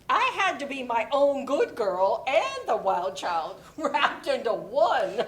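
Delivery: laughing through the words; laughing at her own kookiness. Acted. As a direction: chuckle it out, amused at yourself.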